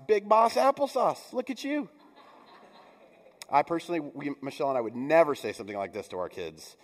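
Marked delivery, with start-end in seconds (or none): 0.1-1.9 put-on high, sing-song praise for a grown kid; doting parent. Parent teasing a grown child, high and sing-song. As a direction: coo it like a proud parent. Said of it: "We say in our house".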